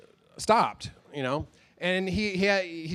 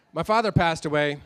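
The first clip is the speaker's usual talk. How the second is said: usual speaking voice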